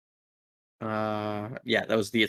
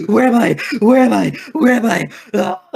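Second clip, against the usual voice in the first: much higher and louder, frantic repeated cries; a dazed captive waking up in terror; panicked confusion; 12 semitones higher, 9 dB louder than usual. Acted. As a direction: thrall snapping out of mind control in a panic. Confused thrall panics after nat one.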